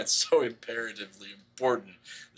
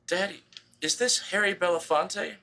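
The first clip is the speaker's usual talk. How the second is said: put-on higher voice of a small girl asking; little girl voice; 9 semitones higher than usual. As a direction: ask it like a curious small child. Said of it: "she's like".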